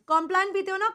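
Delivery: usual speaking voice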